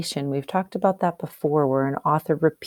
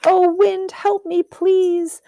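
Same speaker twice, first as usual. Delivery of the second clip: much higher, louder cry for help while tumbling; calling out to the wind; 13 semitones higher, 6 dB louder, slower than usual. Acted. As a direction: call for help while falling through the air. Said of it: "he called out".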